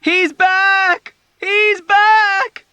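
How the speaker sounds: shrill high scream of panic. A narrator's reading. Dunbar screaming to the ward in terror. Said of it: "Dunbar screamed".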